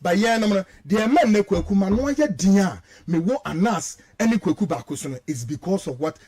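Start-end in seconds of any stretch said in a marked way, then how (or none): none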